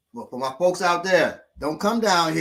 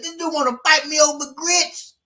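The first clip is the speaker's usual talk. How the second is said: 1 mocking indignation; 13 semitones higher, 4 dB louder than usual. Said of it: "dude said".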